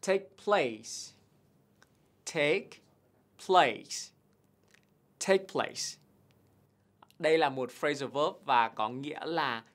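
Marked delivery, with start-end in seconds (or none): 0.1-6.0 "take place" high and clear, each word apart; model pronunciation. Shown as a demonstration. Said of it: "Từ tiếp theo". (đọc mẫu "take place" rõ từng từ)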